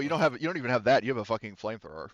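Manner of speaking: usual speaking voice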